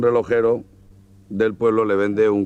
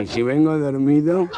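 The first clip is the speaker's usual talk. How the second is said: a touch higher and louder nasal punchline; nasal-voiced man; 6 semitones higher, 4 dB louder than usual. Nasal customer asks the madam, lightly voiced. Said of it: "entra un gangoso".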